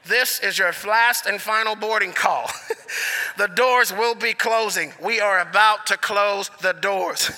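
flat PA boarding call; airport announcement. Retold or imitated. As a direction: read it like a gate agent on the speaker. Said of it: "we hear this".

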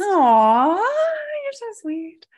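high, slow, melting aw; touched and sweet. Real, not acted. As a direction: gush with affection. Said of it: "That was cute".

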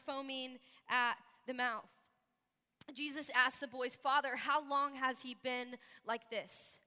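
soft, measured Bible reading; scripture reading. A narrator's reading. Reading the story of the boy's healing to church, measured scripture reading. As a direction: read the passage aloud quietly and evenly. Reads a Bible passage aloud.

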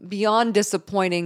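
ordinary delivery

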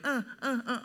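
high, wordless repeated grunts; imitated toddler nagging. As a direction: mimic a toddler's insistent pleading. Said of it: "thirty minutes of him, like".

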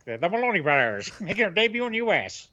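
lightly voiced old-timey announcer; a hint of a newsboy calling headlines; 1920s newsboy voice. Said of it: "preview Top Rank's fights this week in that voice".

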